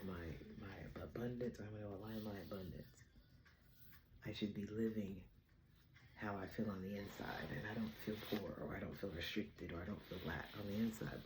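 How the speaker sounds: low, quiet recited intention; hushed self-affirmation, retold; soft affirmation. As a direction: murmur it privately, low and soft. Recites own intention from the bath.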